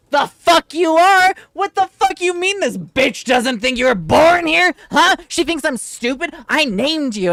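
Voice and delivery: loud, furious outburst at the teacher; mom furious. Retold or imitated. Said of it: "And my mom was just like".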